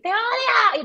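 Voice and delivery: high, loud mock snap; mock hateful snap. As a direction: fling it out like a spoiled diva. Quoting Laura's outburst.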